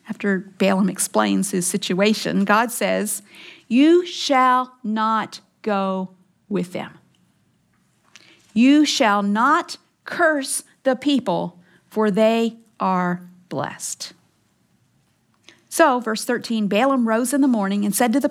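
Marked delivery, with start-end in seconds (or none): 3.7-14.1 very slow, each word set apart; measured command with weighty pauses; solemn divine command. Acted. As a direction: pronounce it as God's decree, slowly and deliberately. Voicing God's command from scripture.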